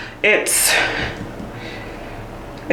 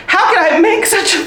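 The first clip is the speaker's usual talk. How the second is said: giggling, pitch jumping higher; cracking up mid-sentence; real laughter spilling out; laughing; 10 semitones higher than usual. Real, not acted. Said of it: "I can't even say it with a straight".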